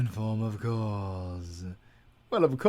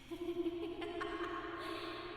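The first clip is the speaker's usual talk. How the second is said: villain laugh; 12 dB quieter than usual. Acted. Villain gloating over captive.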